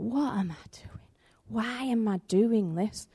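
whispered, slow self-questioning; hushed inner doubt. Retold or imitated. Minister whispering his doubts to himself, slow and hushed. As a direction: breathe it out like a private doubt.

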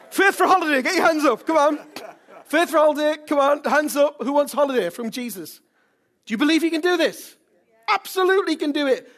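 high, loud rallying shouts; rally the crowd to raise their hands; full-force preaching. Real, not acted. Rallies congregation at full force.

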